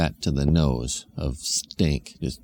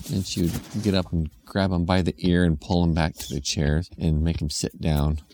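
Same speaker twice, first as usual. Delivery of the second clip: ordinary delivery, nothing marked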